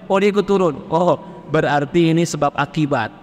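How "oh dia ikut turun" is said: usual speaking voice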